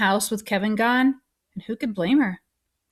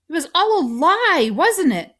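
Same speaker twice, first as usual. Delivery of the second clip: high, loud challenge; upset accusation; 8 semitones higher, 5 dB louder than usual. A narrator's reading. Boy confronting doctor over friend's false hope, upset. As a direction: demand the truth, voice raised.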